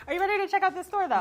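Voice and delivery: high, fast hype question; excited. Acted. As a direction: pump up the guest. Host hyping the store.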